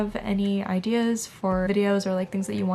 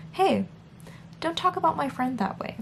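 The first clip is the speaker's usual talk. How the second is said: higher, mock-indignant defense of a friend; protective scolding; 7 semitones higher than usual. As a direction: stick up for yourself like a friend. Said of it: "you should just be like".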